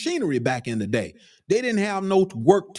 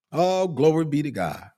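ordinary talk

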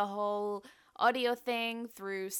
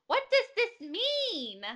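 imitating confused mom; 11 semitones higher, 3 dB louder, slower than usual.

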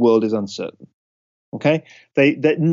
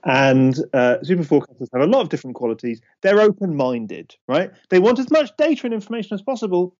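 usual speaking voice

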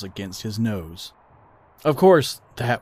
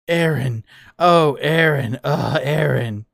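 slow, drawn-out moans, swelling louder; passionate mock moaning; 4 semitones higher, 5 dB louder, slower than usual.

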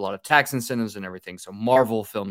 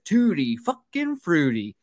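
mock yell; 7 semitones higher, slower than usual. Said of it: "I yelled over to him".

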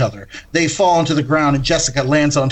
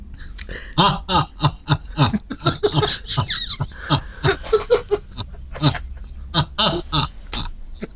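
soft, distant ghostly laugh that swells; a haunting spirit laughing from far off; eerie ghost laugh; 4 semitones higher, 6 dB quieter than usual. Acted. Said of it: "They hear a soft laugh getting louder".

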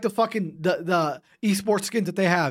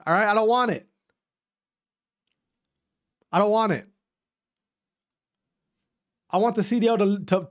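ordinary talk, nothing marked

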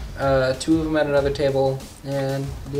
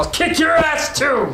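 put-on high, slow drunken yell; belligerent drunk; 14 semitones higher, 5 dB louder than usual. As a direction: bellow like a brawling drunk. Brawling NPC shouts.

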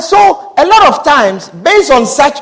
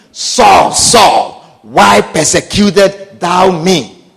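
deep, slow voice of God calling; 6 semitones lower, much slower than usual. Acted. Preacher voicing God calling down to Saul, deep and slow. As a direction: call Saul low and grave.

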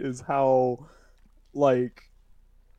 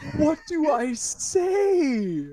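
high, drawn-out loud wail; mock helpless panic; 12 semitones higher, 6 dB louder, much slower than usual. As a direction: stretch it out as an exaggerated desperate plea. Retelling helpless panic at crying friends.

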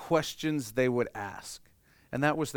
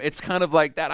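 ordinary talk, nothing marked

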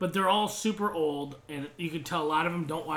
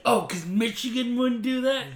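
sarcastic retort; 7 semitones higher, 4 dB louder than usual. Retold or imitated. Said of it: "the lady's like".

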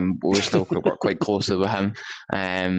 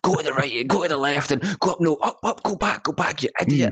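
high-pitched mock yell at usual volume; retold screaming; 7 semitones higher than usual. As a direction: imitate a tutor taking charge, screaming orders. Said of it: "he was screaming at his group".

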